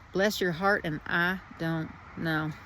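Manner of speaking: put-on Southern accent, slowing at the end. Speaker blessing your heart in a playful Southern accent. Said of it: "in my best Southern accent".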